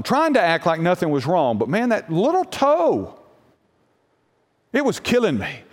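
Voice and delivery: ordinary talk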